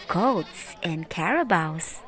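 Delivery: ordinary delivery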